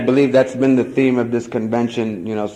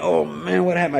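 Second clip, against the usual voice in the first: mild freaking out; 8 semitones higher than usual. Retold or imitated. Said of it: "I'm freaking out".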